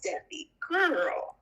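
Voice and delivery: usual speaking voice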